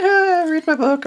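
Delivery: high, slow fake casual; mock nonchalance. Telling friends how he faked calm, high and slow. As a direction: pretend you don't care, airily.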